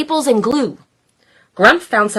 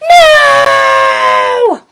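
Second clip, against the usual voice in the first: high, loud crying shout; monster no shout; 7 dB louder than usual. Acted. Grumpy monsters shout their favorite word, loud and high. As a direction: bellow it like a tantrum.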